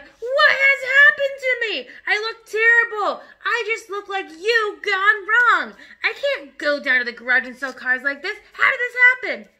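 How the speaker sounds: despairing father bellowing over his ruined hair; horrified yelling. Acted. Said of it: "the father yelled".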